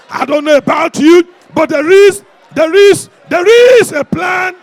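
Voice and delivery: high, loud rallying shout; booming revival preacher's call; full-force preaching. Real, not acted. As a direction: whip the congregation up to their feet.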